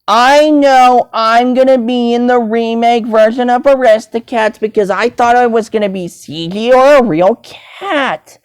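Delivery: ordinary talk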